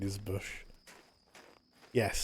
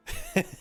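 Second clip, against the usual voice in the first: laugh. Real, not acted. Reacts with a laugh.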